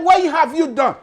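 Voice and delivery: high, loud challenge; demanding crowd. Retold or imitated. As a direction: shout the question as a demand. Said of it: "They said".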